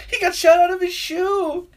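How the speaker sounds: very high, loud, drawn-out exclamation; gleeful outburst. Retold or imitated. Friend exclaiming over a death in the movie, gleeful outburst. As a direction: crow it out high and slow.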